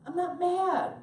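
high, loud protest of not being mad; hammed-up indignant yell; mock angry denial. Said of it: "Why are you so mad".

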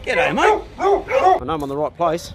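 high, cheery greeting to a dog; high pet talk. Real, not acted. Greeting the dog on arrival at his mate's, high pet talk.

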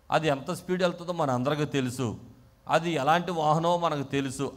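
ordinary talk

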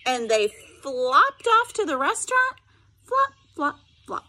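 lively read-aloud voice with sound effects; story narration. A narrator's reading. Teacher reading a picture book to her students, animated storytelling.

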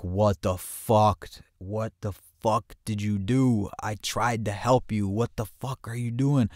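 slow, drawn-out exasperated demand; exasperated, stretching the curses; retold scolding. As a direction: imitate a friend chewing someone out. Friend scolding him on arrival.

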